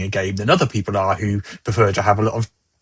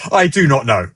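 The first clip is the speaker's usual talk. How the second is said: ordinary talk, nothing marked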